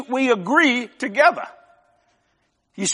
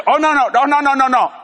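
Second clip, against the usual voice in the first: louder, higher rapid string of no's; mock alarmed objection; 4 semitones higher, 4 dB louder than usual. Boss balking at pilot's costly order, mock alarmed objection. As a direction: pretend to balk at the price, raised voice.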